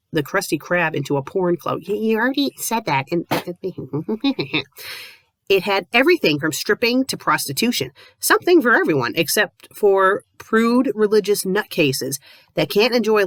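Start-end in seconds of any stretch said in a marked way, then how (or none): none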